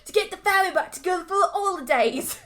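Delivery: high, garbled fake British voice; hammed-up British villain; bad Cockney accent. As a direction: mangle it in overdone Cockney. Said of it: "super bad Cockney".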